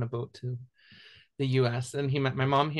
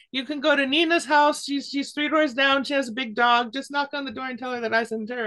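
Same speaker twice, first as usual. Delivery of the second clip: imitating a helpful village woman, much higher; high, friendly neighbour giving directions; eager village woman; 11 semitones higher, 4 dB louder than usual. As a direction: point the way eagerly, high and bright-voiced.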